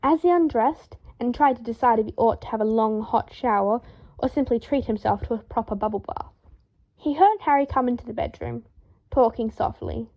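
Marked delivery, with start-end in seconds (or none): none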